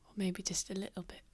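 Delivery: soft, quick whisper; whispered concession. Acted. Tavamil admits Lucian may be hurt, quick whisper.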